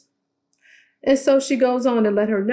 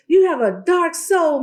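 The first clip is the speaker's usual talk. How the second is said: high mocking voice on "You have a dark soul", a little louder; hammed-up high accusation; mock Tisha; 9 semitones higher, 4 dB louder than usual. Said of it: "and Tisha's like".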